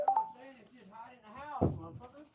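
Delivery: loud, high-pitched irritated outburst; yelling. Real, not acted. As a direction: yell it back in exasperation. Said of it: "Why are you yelling?".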